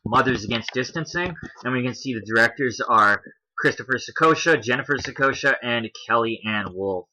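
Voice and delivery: ordinary talk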